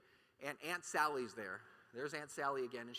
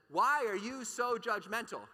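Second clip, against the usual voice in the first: mock accusing aunt; 6 semitones higher, 7 dB louder, slower than usual. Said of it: "Aunt Sally comes back and says".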